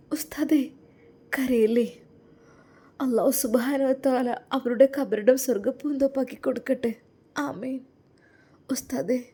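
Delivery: put-on a shy young wife opening up to her husband; timid young woman. Young woman consoles Ustad.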